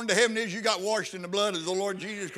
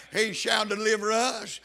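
normal talking voice

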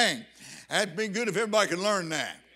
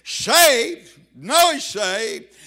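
emphatic shout; 8 dB louder, much slower than usual. Real, not acted. Preacher correcting himself loudly.

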